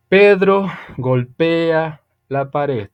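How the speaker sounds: slow, deliberate example sentence; ejemplo dictado lento. Shown as a demonstration. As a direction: spell it out slowly for the class. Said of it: "Sujetos, personas que hacen acciones". (oración de ejemplo dicha muy despacio)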